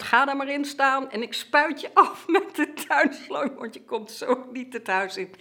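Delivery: lightly acted mum laying down the law; mother's orders. Mother orders child into tub, retold.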